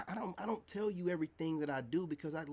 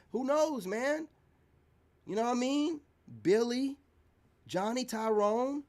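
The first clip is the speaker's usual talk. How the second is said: higher and louder, ticking off names; mocking raised voice; 8 semitones higher, 6 dB louder than usual. Real, not acted. Mocking a woman's many partners, higher and louder.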